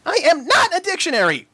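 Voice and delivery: high, huffy filly protest; a little pony's indignant huff; fuming. A narrator's reading. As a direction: fume at your teasing friends. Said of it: "fumed Sweetie Belle".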